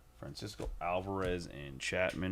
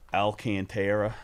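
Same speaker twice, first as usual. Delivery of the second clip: Alcantara with a Texas twang, spoken much louder; 2 semitones higher, 10 dB louder than usual. Retold or imitated. Dudes from Alabama saying the name, Texas twang. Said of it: "twang or Texas twang be".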